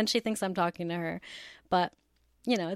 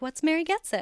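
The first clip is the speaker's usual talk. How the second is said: higher-pitched imitation of a cute kid; cute nephew; 7 semitones higher, faster than usual. Aunt retells her nephew's question about her show, imitating cute nephew. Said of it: "he was so cute. He's like".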